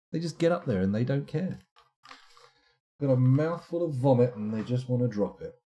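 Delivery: usual speaking voice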